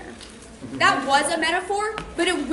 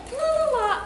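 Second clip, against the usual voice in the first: high, singsong la-la-la; mockingly breezy, higher pitch; mock carefree; 8 semitones higher than usual. Mimics carefree walk along.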